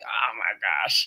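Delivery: slow, low mock dismay; a drawn-out lightly voiced oh my gosh. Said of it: "And I'm like".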